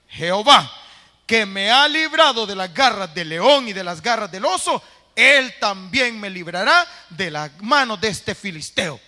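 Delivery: loud, ringing declaration of faith; loud preacher reading David's brave words; bold proclamation. Acted. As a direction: proclaim it with full conviction. David's bold declaration, read aloud.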